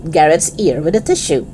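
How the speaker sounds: ordinary delivery, nothing marked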